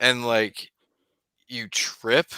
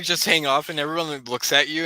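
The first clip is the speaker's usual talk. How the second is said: normal talking voice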